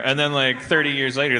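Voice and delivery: ordinary talk, nothing marked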